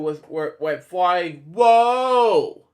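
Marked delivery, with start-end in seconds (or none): 1.5-2.6 a sudden high loud whoa as he flies; startled cry mid-fall; surprised whoa. Acted. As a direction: yelp it like you're losing your grip. Character goes flying off zip line.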